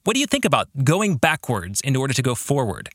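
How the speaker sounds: ordinary delivery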